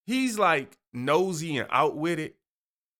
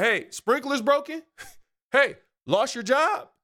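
higher, laughing mock nosy questions; nosy neighbor mock; 8 semitones higher than usual. Nosy neighbor pointing out problems, high laughing mockery. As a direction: poke at someone's problems with a giggle. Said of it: "just about identifying problems in your life".

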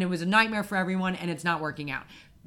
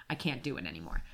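retold plea dropping low and soft; worn-out plea; 7 semitones lower, 9 dB quieter, faster than usual. Exhausted customer giving up on the phone contract, worn-out plea. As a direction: let it sink to a defeated, quiet low.

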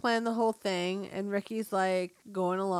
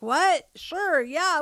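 surprised; 6 semitones higher, 4 dB louder, much slower than usual. Retold or imitated. Ricky caught off guard.

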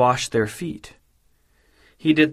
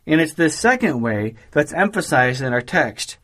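usual speaking voice

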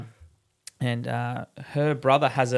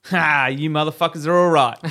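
Pope punchline; 2 semitones higher, 9 dB louder than usual. Acted. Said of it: "looks at the priest and the cardinal and says".

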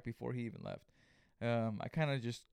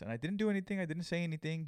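usual speaking voice

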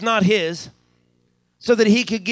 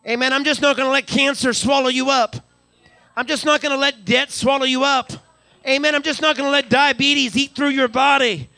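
higher, rising preacher's cry; preaching at full force; 4 semitones higher than usual. Acted. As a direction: build the congregation up to a climax. Preacher building to climax.

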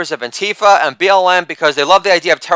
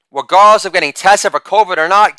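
usual speaking voice

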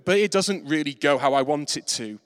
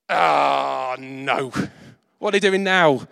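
mock exasperation; 2 semitones lower, 3 dB louder, slower than usual. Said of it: "Jesus then looks across".

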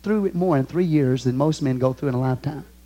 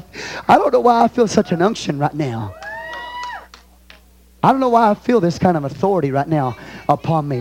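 usual speaking voice

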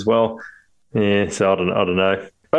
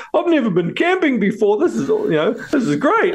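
put-on much higher, eager little-kid voice; excited kid; 11 semitones higher than usual. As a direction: play the thrilled child bragging. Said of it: "And the kid's like".

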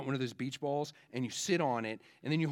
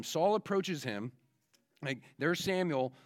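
ordinary talk, nothing marked

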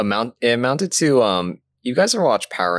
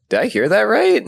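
surprised; 10 semitones higher than usual. Retold or imitated. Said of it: "I was like".

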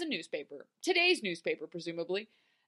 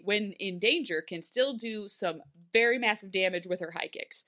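ordinary talk, nothing marked

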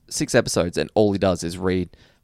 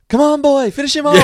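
high, loud cheering Homer; hammy stage actor; 9 dB louder than usual. Retold or imitated. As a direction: yell it like a rowdy dad cheering. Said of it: "I love Homer".

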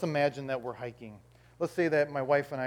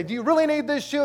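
high-pitched exasperated question; upset; 10 semitones higher, 5 dB louder than usual. Acted. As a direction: demand it in whiny disbelief. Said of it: "I get all upset".

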